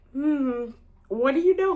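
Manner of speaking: high, amused musing to oneself; mock-pondering, lifted and amused; amused inner thought. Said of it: "laughing to myself".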